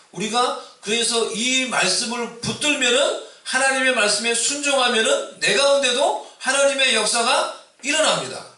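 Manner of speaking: ordinary delivery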